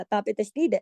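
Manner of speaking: normal talking voice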